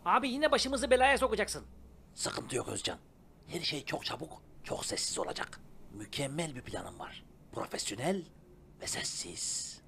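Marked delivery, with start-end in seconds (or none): 2.2-9.8 low, soft, slow villain whisper; sneaky whisper. Acted. Telling Özcan the silent plan in a sneaky whisper. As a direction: hush it like a cartoon schemer. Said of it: "Sessiz planı varmış".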